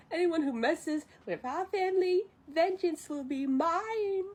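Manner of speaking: lightly acted high vengeance vow; mock solemn vow. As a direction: declare vengeance, half in play.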